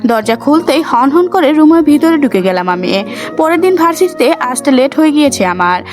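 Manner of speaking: plain reading voice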